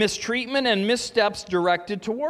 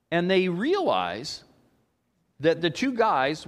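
ordinary talk, nothing marked

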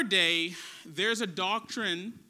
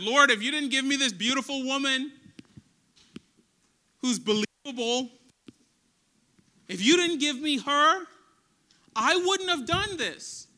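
louder, rising, fast defensive pleading; Adam's excuse; 4 semitones higher, 6 dB louder than usual. Retold or imitated. Adam blames God for the woman, defensive and pleading. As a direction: whine your excuse to God, pushing the blame.

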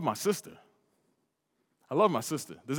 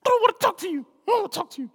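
put-on high, pouty child voice, much higher; proud sulking sister; 3 dB louder, much faster than usual. Hurt sister refusing to talk to her brother, high and pouty. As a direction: sulk like a proud little girl. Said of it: "prideful Dominican that she is".

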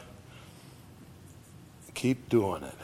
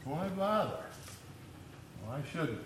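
scoffing doubters; 3 semitones higher, 13 dB quieter than usual. Retold or imitated. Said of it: "That was their attitude".

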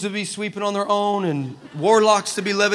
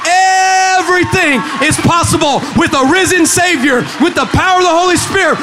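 high, loud, drawn-out shouted declaration; full-force preaching; 8 semitones higher, 8 dB louder, slower than usual. Real, not acted. Preacher telling the church what God makes possible, shouting. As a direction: build to a roaring sermon climax.

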